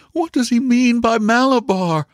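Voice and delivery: very high, urgent question; distraught mother. A narrator's reading. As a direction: demand an answer in a high-pitched voice. Frightened mother questions the father.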